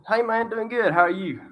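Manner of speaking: breezy reply, voiced a bit high; a light imitation of a classmate's easy answer; imitating the guy. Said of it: "He said".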